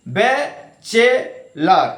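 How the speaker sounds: ব্যাচেলার spoken very slowly, syllables pulled apart; teacher slowly sounding out ব্যাচেলার for the class; slow syllable demo. Shown as a demonstration. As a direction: stretch ব্যাচেলার out one syllable at a time. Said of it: "B A".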